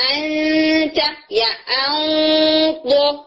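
انت یا اون hummed long through the nose; nasal ghunna demo. Shown as a demonstration. Teacher showing the sound goes through the nose, sustained hum. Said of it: "ناک میں آواز لے کے جا رہے ہیں".

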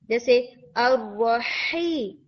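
अर्रही slow with audible air on the ह; audible breathy ha. Shown as a demonstration. Teacher showing whispered quality of ha. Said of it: "आप इस हम्स को सुन सकते हैं".

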